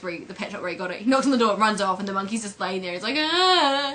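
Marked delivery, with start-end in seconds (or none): 3.2-3.9 high, loud imitated groan; put-on feeble monkey moan; drawn animal whine. Imitates monkey lying there.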